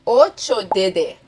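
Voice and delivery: ordinary talk, nothing marked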